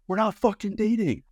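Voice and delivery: high, indignant protest; mock angry denial. Customer denying they are a couple, indignant and high.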